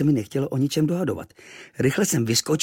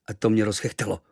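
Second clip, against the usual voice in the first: usual speaking voice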